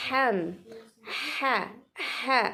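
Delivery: "ha" as a light breathed throat h; small ha. Shown as a demonstration. Teacher isolates the letter for students, small breathed "ha". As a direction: isolate the small Arabic ha in "ham", "ha". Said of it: "Huruf kecil".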